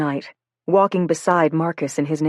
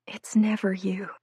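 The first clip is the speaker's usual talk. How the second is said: whisper; 3 semitones higher, 6 dB quieter than usual. Acted. Crying girl reassuring Marcus.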